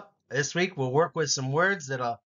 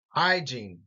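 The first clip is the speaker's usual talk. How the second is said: HY-giene, first syllable hit; first syllable stress. Shown as a demonstration. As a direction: stress the start of hygiene. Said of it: "first syllable emphasis".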